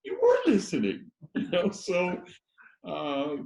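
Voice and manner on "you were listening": higher, louder delighted surprise; amazed that Ringo took it all in; surprised. Retold or imitated. [name] to Ringo, delighted he was listening. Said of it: "I'm like".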